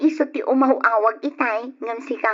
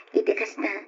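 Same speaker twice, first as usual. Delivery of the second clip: normal talking voice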